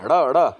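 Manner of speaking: ड़, ड़ as loud, separate retroflex flaps; correct ड़. Shown as a demonstration. Teacher drilling the sound, ड़ repeated loudly and distinctly. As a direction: punch out each ड़ with the tongue curled back. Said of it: "सड़क है".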